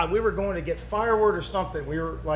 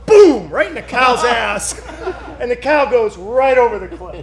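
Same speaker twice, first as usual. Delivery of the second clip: laughing; 5 semitones higher, 10 dB louder than usual. Real, not acted. Laughing retelling of the cow.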